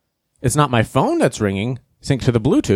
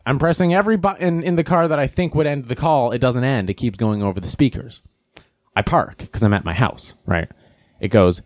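normal talking voice

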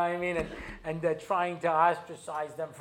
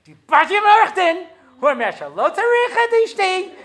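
fast, very high and loud rant; furious rapid yelling at the clerk; 13 semitones higher, 14 dB louder, much faster than usual. Retold or imitated. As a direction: shout it all out in one breath.

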